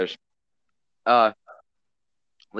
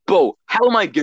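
indignant mock protest; 7 semitones higher, 6 dB louder than usual. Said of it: "he's like".

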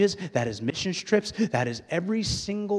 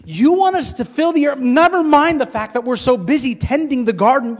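high, loud, overwhelmed pleading with God; exasperated shouted protest; 12 semitones higher, 15 dB louder than usual. Acted. Adam protesting God's huge task, exasperated shouting. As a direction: shout it in disbelief, stretching key words.

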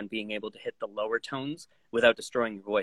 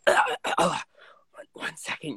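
faltering actor; 5 semitones higher than usual. Retold or imitated. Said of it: "someone on the second line go".

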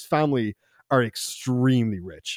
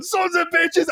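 high-pitched mock furious scream; retold screaming; slower than usual. Tased man cursing at armed police, mock scream. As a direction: imitate helpless rage from the ground. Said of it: "he starts screaming at them".

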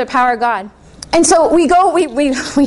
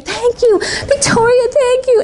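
retold tearful thanks; 9 semitones higher than usual. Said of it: "she starts crying and weeping".

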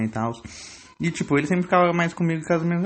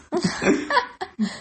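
a big squealing laugh up high; real laughter, high and bright; high burst of laughter. Real, not acted. Laughs at own story.

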